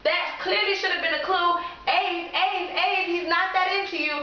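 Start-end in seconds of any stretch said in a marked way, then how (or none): 1.9-4.1 high, sing-song chiding; mock-scolding her younger self; teasing scold. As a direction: tease yourself for missing it.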